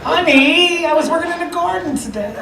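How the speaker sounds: high, soft wifely voice; coy new bride. Acted. New bride hasn't showered after gardening, high coy voice. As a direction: play a sweet bride, high and softly.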